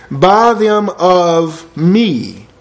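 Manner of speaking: each word drawn out slowly; very slow emphasis. Shown as a demonstration. Preacher stresses Jesus' words, very slow. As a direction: linger on buy them of me.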